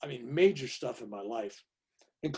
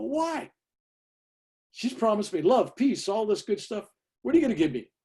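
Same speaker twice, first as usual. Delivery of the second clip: incredulous protest; 8 semitones higher, 5 dB louder than usual. Retold or imitated. Retold argument with the guru.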